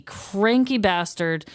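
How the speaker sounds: ordinary delivery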